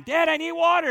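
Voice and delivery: calling out. Retold or imitated. Third call for water.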